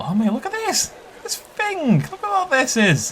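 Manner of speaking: high, thrilled exclamations; excited squeal of discovery; real amazement. Real, not acted. Amazed at display.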